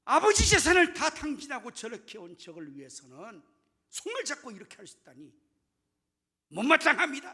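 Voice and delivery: high, loud burst of indignation; angry complaint. Acted. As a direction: lash out at your father in protest, hitting the start and end hard. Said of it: "아버지에게 막 분노하고 불평하고". (분을 못 참고 높고 큰 소리로 따지듯)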